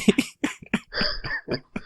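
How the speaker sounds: squeaky high breathless giggle; high unvoiced giggle; girlish giggle. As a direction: giggle like a little girl. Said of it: "I just started giggling like a fucking little girl".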